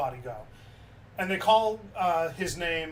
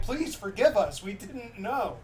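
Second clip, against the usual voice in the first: pleading; 4 semitones higher, slower than usual. Retold or imitated. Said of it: "they were begging on their knees".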